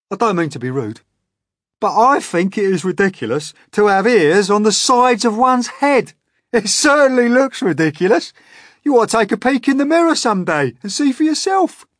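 high, loud impudent centipede; cheekily mock the boy's ears; cheeky centipede. A narrator's reading. Centipede rudely teasing James about his ears, high and loud.

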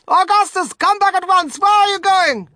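very high, loud frantic mother; screaming. A narrator's reading. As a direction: shriek after your vanishing son. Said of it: "screamed Missus Gloop".

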